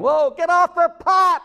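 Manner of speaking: high, loud yell at a sleeping god; screaming, yelling. Acted. Priests of Baal shouting at their god, high loud yelling. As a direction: holler it up high and loud, wake him up. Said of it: "they're screaming, yelling, hollering".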